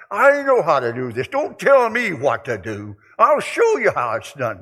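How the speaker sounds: hammed-up self-sure boast; mock stubborn boast. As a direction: play the proud man who won't be told. Acting stubborn self-reliant voice.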